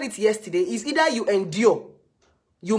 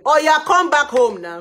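mock mother calling loudly; 8 semitones higher, 10 dB louder than usual. Mother urging her on the phone.

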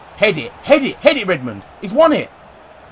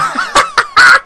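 high squealing laugh of joy; shrieking laugh; 6 dB louder than usual. Real, not acted. Goal celebration.

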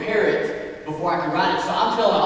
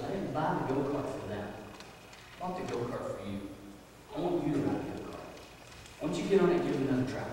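gentle fatherly urging; 3 semitones lower, 12 dB quieter, faster than usual. Retold or imitated. Dad encouraging son gently.

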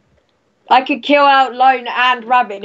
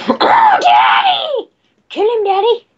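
high, drawn-out laughing shout, higher still on kill him; mock cheering squeal; 8 semitones higher, slower than usual. Gamer hypes a teammate, hammed-up squealing shout.

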